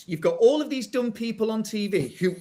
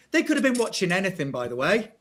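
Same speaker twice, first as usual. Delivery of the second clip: normal talking voice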